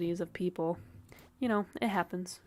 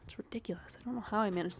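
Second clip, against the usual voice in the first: whispered grumble; 7 dB quieter, faster than usual. Real, not acted. Annoyed aside stuck on corners.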